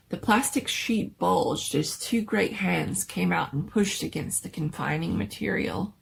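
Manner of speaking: ordinary delivery, nothing marked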